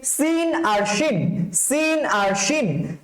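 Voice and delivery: slow thin hissed সিন, then full "sh" শিন; sin versus shin. Shown as a demonstration. Teacher sets two letters apart, slow সিন and শিন. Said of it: "tyre পাঞ্চের যে আওয়াজ".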